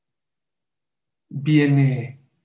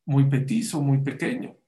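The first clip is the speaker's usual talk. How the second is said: ordinary delivery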